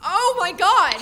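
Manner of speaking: loud, high cry of shock; terrified cry. Acted. Girl sees a skeleton climb out, terrified cry. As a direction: cry out in horror.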